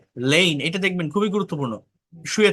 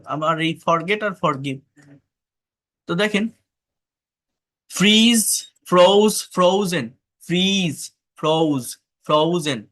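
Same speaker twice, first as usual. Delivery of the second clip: ordinary delivery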